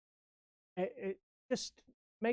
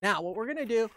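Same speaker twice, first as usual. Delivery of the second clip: ordinary talk, nothing marked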